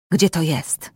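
low hissed threat; hissed question. A narrator's reading. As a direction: hiss where is it. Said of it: "syczał napastnik". (syczące pytanie)

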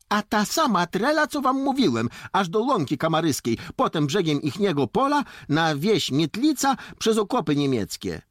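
high, loud eager listing; insistent retort. Acted. Dońka describes the forest route to Jakub, insistent. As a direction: argue back in a high boyish voice, step by step. Boy argues the route back.

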